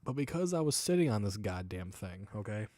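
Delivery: normal talking voice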